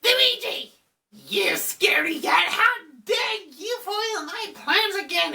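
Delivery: high, theatrical ghost villain; hammed-up villain rant, squealed high and menacing; King Boo. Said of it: "King Boo's like".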